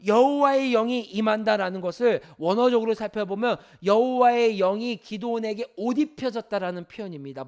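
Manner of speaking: ordinary delivery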